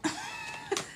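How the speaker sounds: short high-pitched giggle; high squeaky laugh. Real, not acted. Vlogger cracking up at her cat story, squealing laugh.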